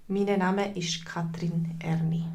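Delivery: whispered introduction. Shown as a demonstration. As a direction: say your name in a soft whisper. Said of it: "models self-introduction". (geflüsterte Vorstellung)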